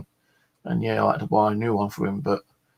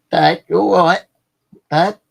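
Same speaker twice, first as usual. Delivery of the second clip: retold call, higher and louder; 9 semitones higher, 8 dB louder than usual. Daughter calling across the yard to her dad, raised voice. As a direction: call out to someone out of sight.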